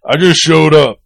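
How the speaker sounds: loud, high, hammed-up self-importance; mock big shot. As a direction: boast it loudly like a pompous latecomer. Mocking the late journalist.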